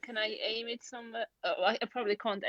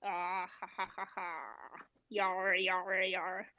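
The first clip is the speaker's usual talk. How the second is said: half-hearted pirate cheer, trailing softer; mock pirate; 2 semitones lower, 4 dB quieter, slower than usual. Player celebrating the fifteen cannonball, mock pirate.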